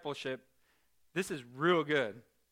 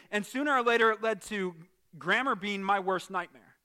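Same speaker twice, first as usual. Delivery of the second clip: normal talking voice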